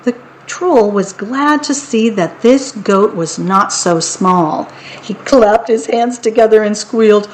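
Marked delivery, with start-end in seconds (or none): none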